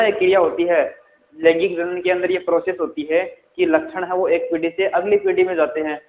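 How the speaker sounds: ordinary delivery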